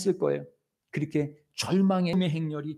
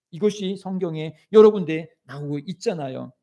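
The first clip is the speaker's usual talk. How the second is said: normal talking voice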